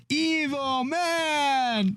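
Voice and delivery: very high, slow drawn-out cry; Tommy yelling. Retold or imitated. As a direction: stretch it out in a strange high wail. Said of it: "he was like".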